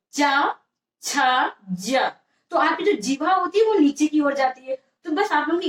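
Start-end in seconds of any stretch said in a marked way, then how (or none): 0.1-2.1 चा, छा, जा as isolated open syllables; sound demonstration. Shown as a demonstration. Teacher showing where the tongue goes, चा, छा, जा sounded out separately. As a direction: sound out चा, छा, जा with a gap after each. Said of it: "जब आप गए चा बोलते हो".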